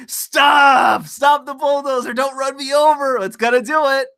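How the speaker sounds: high, shouted mock panic; mock panicked guard. Mike Lee mocked as the guard, high panicked shouts.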